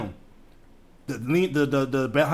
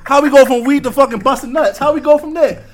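louder, higher disbelieving yell; incredulous outburst; 9 semitones higher, 11 dB louder than usual. Real, not acted. Friend reacting to the weed-to-sex twist, loud and incredulous. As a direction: shout it in amused disbelief.

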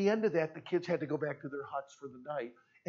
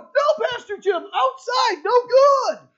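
put-on very high, loud children's shouting; kids shouting; 14 dB louder, slower than usual. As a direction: yell it out like frantic children. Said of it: "And they went".

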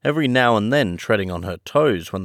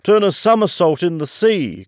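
high, louder excited cry; acted gleeful exclamation, pitched way up; 10 semitones higher, 5 dB louder than usual. A narrator's reading. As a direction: exclaim it high, caught up in the game. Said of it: "cried the Mock Turtle".

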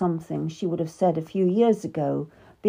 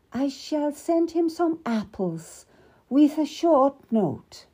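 a dignified queen speaking with pity; Queen Catherine's voice; 8 semitones higher, 3 dB louder than usual. Acted. Queen to her lady, pitying the cardinal, higher and measured.

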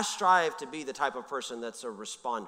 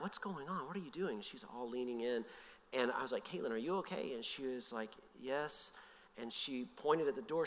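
hushed storytelling; 2 semitones lower, 11 dB quieter than usual. Retold or imitated. Telling the congregation about Caitlin in the old truck, hushed. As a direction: pull listeners in with a quiet voice.

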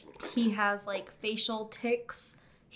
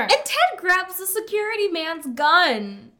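loud, high, slowed-down storytelling; hammed-up shouted narration; dramatic climax; 9 semitones higher, 11 dB louder than usual. Co-host retelling Ted grabbing the gun, dramatic shout.

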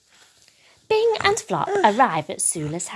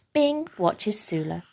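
ordinary delivery

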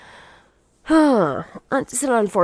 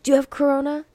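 mock worried coworkers; 8 semitones higher, faster than usual. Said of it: "everyone at work was like".

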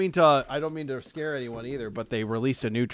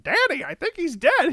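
put-on high, small child voice; high, scared little boy; scared kid voice; 6 dB louder than usual. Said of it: "he's just like".

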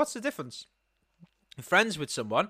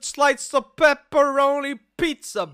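put-on slow, higher, relished Italian-American accent; mock Italian-American; 5 semitones higher, 4 dB louder, slower than usual. Brit topping the food-order bit, hammed-up Italian-American accent. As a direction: savour each word like a cartoon Italian. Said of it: "it's always like with the Italians".

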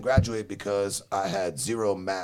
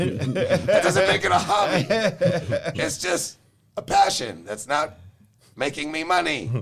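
laughing; 9 semitones higher, 6 dB louder than usual. Real, not acted. Laughing reply to dad.

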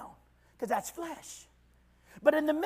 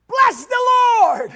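sudden shout; 9 semitones higher, 8 dB louder than usual. Acted. Preacher erupting at the congregation, shouting. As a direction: bless shouted high and loud.

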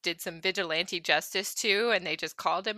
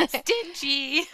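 teasing; 5 semitones higher than usual. Real, not acted.

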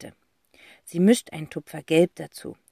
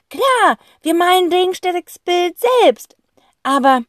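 high, louder, slow and excited; eager boy voice; 12 semitones higher, 8 dB louder than usual. Acted. Boy explaining the plan to his sister, eager high voice. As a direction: play the boy with a bright idea, higher. Zack figure voice.

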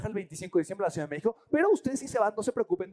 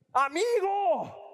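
high, slow, stretched "Amigo"; old friend stretching out the greeting; drawn-out friend greeting; 11 semitones higher, much slower than usual. Retold or imitated. Friend at the airport greets him, high and drawn out.

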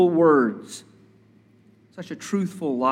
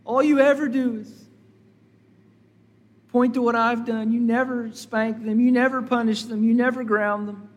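higher-pitched imitation of a child's protest; complaining child; 6 semitones higher than usual. Child accuses parents of never punishing a sibling, mock complaint. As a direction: pile up the grievances in a high voice, imitating a child. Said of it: "some of your children say".

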